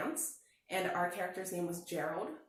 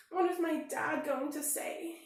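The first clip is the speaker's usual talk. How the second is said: higher, slow anxious question; worried; 7 semitones higher, slower than usual. A narrator's reading. Bear cub worrying about his dad, higher and slow. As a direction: fret over it. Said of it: "he worried".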